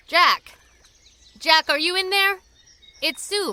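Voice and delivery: loud call toward the cabin. Acted. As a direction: call his name across the yard. Calling out to the cabin.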